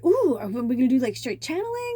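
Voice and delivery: eager, rising high voice; excited little fairy. Retold or imitated. Voicing her little fairy guessing at plans, excitedly. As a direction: wonder aloud with giddy hope. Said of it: "my little fairy was just so excited".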